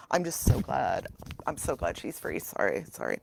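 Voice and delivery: hammed-up choked-up fan, fading away; mock emotional. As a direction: voice sinking low and soft, overcome. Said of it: "I get really emotional about this".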